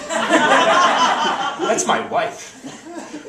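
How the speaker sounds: drawn-out, high indignant warning; tough protective husband. Acted. Husband confronts mugger going for wife, indignant. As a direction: stake your claim, stretching it out.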